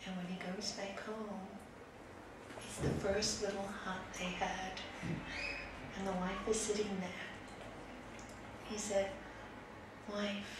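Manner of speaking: soft, measured storytelling with long pauses; subdued narration and small, humbled character voices; hushed story ending. A narrator's reading. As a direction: wind the fable down quietly.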